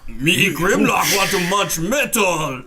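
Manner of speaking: slow dim-witted robot dinosaur; Grimlock voice. Acted. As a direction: speak slow and dumb like a brute.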